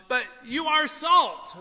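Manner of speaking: slow, loud preaching punch on are; emphatic shout. Real, not acted. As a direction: call it out to the congregation. Preacher drives home main point.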